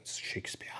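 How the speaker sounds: hushed unvoiced "Shakespeare."; Picard whisper. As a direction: breathe the word like a grand secret. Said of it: "he's like".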